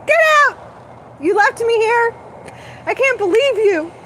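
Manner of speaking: high, loud mock fit; hammed-up tantrum thrown at the star beings; retold tantrum. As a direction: yell like a furious, abandoned child. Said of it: "I'll be, like, throwing fits".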